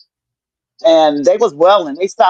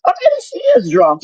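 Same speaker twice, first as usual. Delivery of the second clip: high-pitched mocking guess; 13 semitones higher than usual. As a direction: voice jumps up high on oh. Retelling family's guesses.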